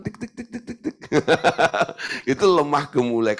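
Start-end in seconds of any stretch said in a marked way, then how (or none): 0.0-1.0 high, soft little tik sounds for tiny steps; dainty heel clicks. Retold or imitated. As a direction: tap out dainty footsteps with the voice. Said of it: "pokoknya pelan".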